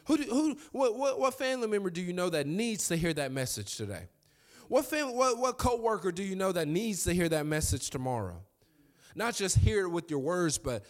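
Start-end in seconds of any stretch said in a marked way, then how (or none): 0.1-8.4 high-pitched preaching. Acted. Preaching at full force.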